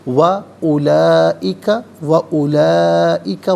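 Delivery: و اولئک with the long vowel drawn out; stretched madd. Shown as a demonstration. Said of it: "اسی طریقے سے کھینچ کر پڑھنا ہے".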